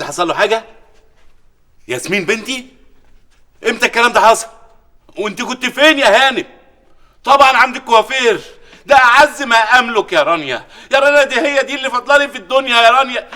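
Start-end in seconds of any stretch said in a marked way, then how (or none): 3.7-13.3 alarmed outcry. Acted. Father hears daughter kidnapped.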